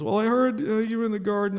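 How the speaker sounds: high, halting voice of a caught Adam; hesitant Adam. Acted. Adam answering God, hesitant and high. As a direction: stall for time like a guilty man. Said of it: "that's inflection, that's my interpretation".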